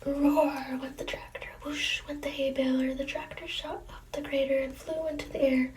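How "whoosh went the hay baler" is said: usual speaking voice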